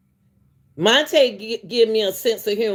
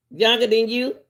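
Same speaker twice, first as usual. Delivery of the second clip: ordinary talk, nothing marked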